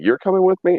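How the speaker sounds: high, insistent; high demanding. Retold or imitated. Making his friends ride along, high and insistent. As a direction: squeak it out as a demand. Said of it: "I'm like".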